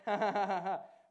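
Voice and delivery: loud staged chuckling burst; defiant laugh. Acted. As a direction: laugh loudly in the devil's face. Laughing at the devil.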